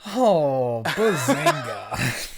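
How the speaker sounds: loud, slow, drawn-out catchphrase parody; sitcom catchphrase. Retold or imitated. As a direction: ham it up, stretching the last word.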